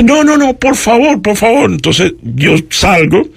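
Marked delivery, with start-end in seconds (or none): 0.0-1.7 high, eager woman's voice; kind insistent woman. Retold or imitated. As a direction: urge him politely, pitched up. (imitando a una señora amable e insistente, voz aguda)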